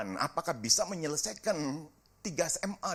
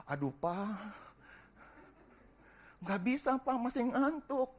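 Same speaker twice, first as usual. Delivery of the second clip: whiny sleepy son; 7 semitones higher than usual. Retold or imitated. Son whining to father.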